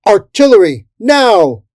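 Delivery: very loud, high command; shouted order. A narrator's reading. Jimmy Dugmoss ordering the artillery, shouted command. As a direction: yell the order to fire.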